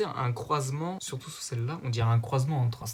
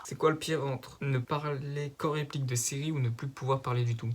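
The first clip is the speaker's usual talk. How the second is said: usual speaking voice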